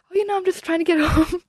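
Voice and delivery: high, sing-song nonchalance with a small laugh; retold fake casual. Daughter reassuring mom on the phone, imitated fake casual. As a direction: play it breezy to hide the panic. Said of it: "trying not to freak her out".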